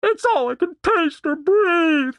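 high, slow wailing; drawn-out anguished whine; about to cry. Acted. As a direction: lament tearfully, pitch held way up. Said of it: "like she was about to cry".

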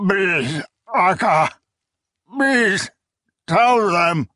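high, cracking cries of begging; desperate pleading. Acted. Bloodied prisoner begging his old friend, desperate high pleading. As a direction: beg for your life, voice breaking high.

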